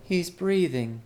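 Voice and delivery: a lightly voiced young maid; maid's voice. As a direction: pitch it up for the maid. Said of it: "she said".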